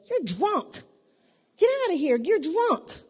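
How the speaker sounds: high-pitched mock scolding; exaggerated, high dismissive rebuke; blunt rebuke. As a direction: act out a scandalized priest telling someone off. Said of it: "He didn't say it right".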